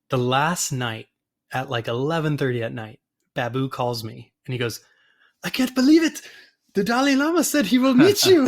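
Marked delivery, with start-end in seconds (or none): 5.4-8.5 put-on high, loud excited Indian accent; imitating Babu's Indian accent, thrilled. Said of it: "I probably shouldn't speak in an accent".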